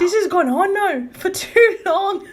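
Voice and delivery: high, slow Scottish parody; exaggerated mock-Scottish drawl; mimicked Scottish accent. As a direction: teasingly copy a Scottish lilt. Mimicking friend's Scottish-sounding accent.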